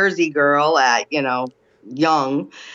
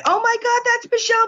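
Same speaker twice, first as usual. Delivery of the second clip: much higher, thrilled fans shrieking a name; excited fan squeal. Retold or imitated. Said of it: "two hundred fan club members that went".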